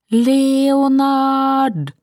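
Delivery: long, high, loud drawn-out shout; calling out a name. A narrator's reading. Woman on the shore calls after a departing boat, shouting desperately. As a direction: holler it far out across the water. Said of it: "ropte navnet hans".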